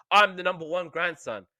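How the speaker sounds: put-on high, insistent little boy; boastful kid. Tommy to Dill, flicking the light back on, high and boastful. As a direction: chant it like a jealous kid.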